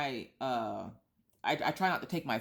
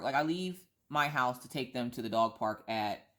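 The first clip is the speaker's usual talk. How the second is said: normal talking voice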